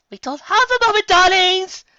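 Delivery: very high, loud posh alarm; urgent theatrical warning; high loud diva panic. Acted. Diva warns the group.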